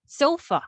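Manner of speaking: "Sofa" with SO pitched high, then dropping; first-syllable stress. Shown as a demonstration. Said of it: "dyan yung stressed syllable or yung emphasis".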